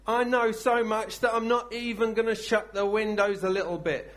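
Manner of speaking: loud, high, earnest vow of a doomed man; a condemned man telling the Lord yes; Daniel's resolute prayer. Acted. Daniel to the Lord, knowing the lions wait: loud and high.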